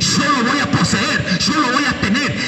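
high, loud preacher's cry over music; fiery preaching. Acted. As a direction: preach it hard, pushing every line. Preaching at full force.

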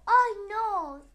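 loud high oh no; dismayed cry. Acted. Mage realising he cast the wrong spell, loud dismayed shout.

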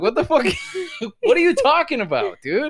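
high, loud disbelieving shout; incredulous outburst. Retold or imitated. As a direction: react in shocked disbelief. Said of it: "And I'm like".

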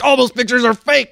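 high, dismissive put-on voice; high mock bigot. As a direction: wave it off in a high silly voice. Bigot denies photos.

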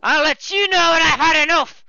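put-on loud, high drunk protest; angry drunk. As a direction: snap back, drunk and loud.

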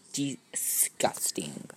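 slow, soft whispered disgusting. Real, not acted. Her cuticles look disgusting, slow whisper. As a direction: breathe the word out in distaste.

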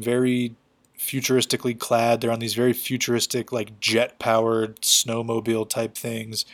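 usual speaking voice

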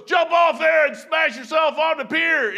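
slow, loud command hollered upward; acted admiral yelling each word up high; shouted order.

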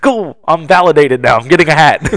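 loud, high-pitched mock celebration; retold triumph. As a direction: crow it like a small victory. Retold triumph at being recognized.

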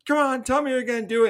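lightly acted angry dare; super mad, retold. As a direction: goad her in a hurry, imitating an angry man. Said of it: "he's, like, super mad".

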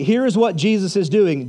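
ordinary delivery

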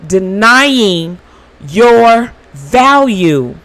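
slow, drawn-out commands; slow, deliberate inner voice; mirror of truth speaking. Retold or imitated. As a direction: lay each word down slowly, like a verdict. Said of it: "the mirror of truth says".

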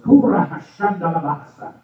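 high, loud burst of tongues; speaking in tongues. Real, not acted. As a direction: break into tongues with fervour. Speaking in tongues mid-story.

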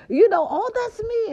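ordinary talk, nothing marked

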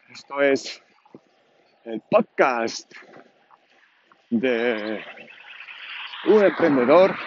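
mock show-opener announcement, drawn out with pauses; podcast intro announcer. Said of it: "tener una cabecera".

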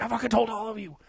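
high-pitched crowing I-told-you-so; mock triumphant shout. Bragging to everyone with screenshot.

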